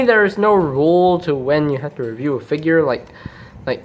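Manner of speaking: ordinary delivery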